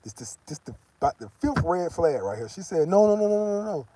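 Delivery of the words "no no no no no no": higher, imitating her insistent no; a hammed-up, pushy girlfriend; mock girlfriend voice. Imitating girlfriend steering him away from Western Union, higher.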